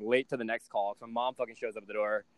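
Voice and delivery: usual speaking voice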